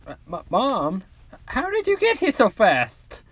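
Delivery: put-on a boy caught off guard, stuttering up high; startled Deku. Deku startled by his mother.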